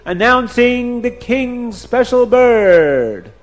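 high, drawn-out proclamation; herald's announcement. A narrator's reading. Herald presents the king's special bird, high slow proclaiming. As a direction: announce it like a royal herald, stretching the words.

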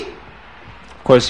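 ordinary delivery, nothing marked

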